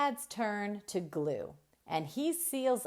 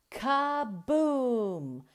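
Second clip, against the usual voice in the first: big kaboom; 6 semitones higher, 4 dB louder than usual. A narrator's reading. Tow truck explodes.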